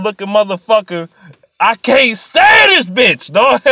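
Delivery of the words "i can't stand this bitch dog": stretched, frustrated shout; raised, exasperated wail. Real, not acted. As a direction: cry out that you've had it. Ranting about a hated team owner.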